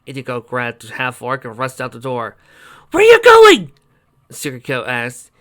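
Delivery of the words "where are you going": very high, loud shout; calling after. A narrator's reading. Friend yelling after runaway party, high and loud. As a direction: call after someone running off.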